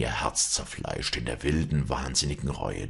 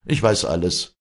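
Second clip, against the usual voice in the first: firm, louder stern priest; 5 semitones higher, 8 dB louder than usual. A narrator's reading. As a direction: cut her off sternly. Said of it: "sagte er streng".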